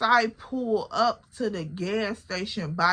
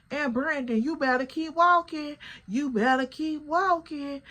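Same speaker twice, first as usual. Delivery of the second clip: higher mock warning; imitating a girl telling her man to keep walking; warning Brandon; 7 semitones higher than usual. Girl warning her dude Brandon off, higher mock warning. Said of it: "I hear the other girls like".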